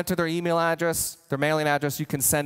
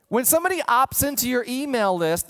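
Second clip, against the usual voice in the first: ordinary talk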